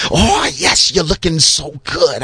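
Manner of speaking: low, crooning vampire-ish preacher voice; Blackula-like preacher. Retold or imitated. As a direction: purr it like a smooth seductive preacher. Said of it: "sound like Blackula".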